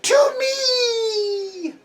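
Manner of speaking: shrieking high-pitched madwoman; cackling mad cultist calling out; mad scientist. Acted. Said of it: "begins cackling madly and says".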